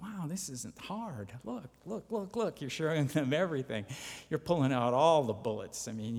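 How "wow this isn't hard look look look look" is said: high-pitched, drawn-out insistence; mildly exasperated inner voice, pitched up; imagined frustration. Pretend. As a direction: plead higher, as if they just don't get it. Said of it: "So when you get frustrated".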